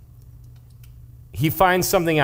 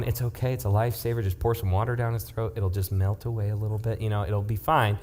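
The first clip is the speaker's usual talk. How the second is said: softer, calm reassurance; reassuring; 6 semitones lower, 6 dB quieter than usual. Retold or imitated. Dad on the phone calming his panicked wife, soft and reassuring. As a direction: talk a worried mom down gently. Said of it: "I'm, I'm going".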